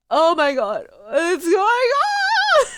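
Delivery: loud cry climbing high and drawn out; distraught wail. Real, not acted. Player overwhelmed by Gregson's death, rising tearful wail.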